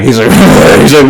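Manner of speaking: ordinary delivery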